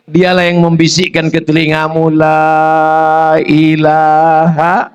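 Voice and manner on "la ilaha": very slow, drawn-out prayer; tender deathbed prompt. Retold or imitated. Wife guiding her dying husband through the creed, very slowly. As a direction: stretch each syllable gently.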